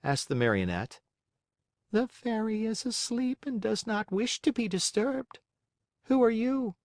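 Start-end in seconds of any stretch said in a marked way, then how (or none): 1.9-6.8 put-on a calm old snail calling down. Snail answers from the window.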